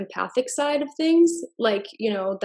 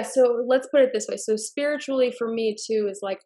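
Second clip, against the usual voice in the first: ordinary talk, nothing marked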